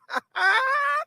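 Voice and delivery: high, drawn-out exultant laugh; triumphant laugh. Real, not acted. Supporter sure the leader foresaw this, triumphant laugh.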